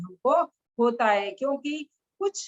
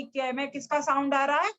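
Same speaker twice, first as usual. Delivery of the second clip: usual speaking voice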